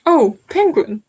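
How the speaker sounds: normal talking voice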